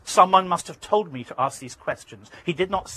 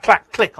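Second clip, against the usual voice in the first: imitated gun click; 7 semitones higher, 11 dB louder than usual. Imitating rifle bolt.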